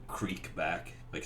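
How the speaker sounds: ordinary talk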